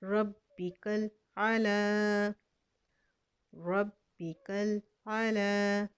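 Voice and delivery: रब्बिकल आला with the final "aa" drawn out one beat; lengthened ending. Shown as a demonstration. Teacher demonstrates the lengthening, slowly. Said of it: "एक हरकत के बराबर खींचेंगे".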